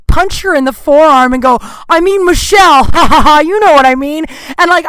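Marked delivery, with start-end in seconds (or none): 1.9-4.3 loud high laughing cover-up; blurting a correction through laughter; nervous laughing. Retold or imitated. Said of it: "can't stop laughing".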